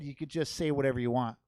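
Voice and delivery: ordinary delivery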